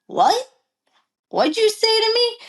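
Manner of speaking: high-pitched, affronted retort; indignant challenge. Retold or imitated. Jockey challenging the rival who cursed her, high indignant retort.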